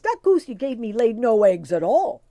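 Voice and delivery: loud, indignant Irish voice; angry complaint. A narrator's reading. Tim angrily accusing the wee folk of a useless goose. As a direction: accuse them to their faces. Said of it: "cried Tim to the wee folk".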